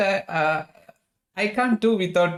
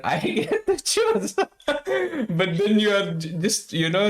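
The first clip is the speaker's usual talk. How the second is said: giggling while teasing back; laughing; 6 semitones higher than usual. Real, not acted. Host teasing the guest about choosing, laughing.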